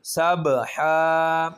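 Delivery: सब हा drawn out slowly; pausal form. Shown as a demonstration. Teacher demonstrating the waqf reading. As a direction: stop on the word and stretch हा. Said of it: "वक्फ करेंगे तो".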